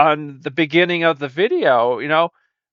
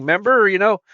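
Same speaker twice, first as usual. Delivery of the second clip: ordinary talk, nothing marked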